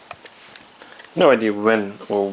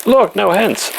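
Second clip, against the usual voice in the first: excited; 10 semitones higher, 4 dB louder than usual. Real, not acted. Showing off riding one-handed.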